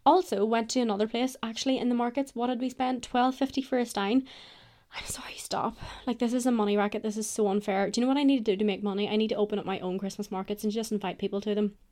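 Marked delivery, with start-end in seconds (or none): 4.9-5.8 unvoiced whisper, pleading stop; hushed mock-pained whisper; whispered protest. Real, not acted. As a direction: breathe it out like you can't take it. Outraged at market prices.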